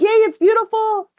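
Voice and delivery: high, bright mock-gushing squeal. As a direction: squeal it like an excited fan reading a comment. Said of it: "And Jesse's like".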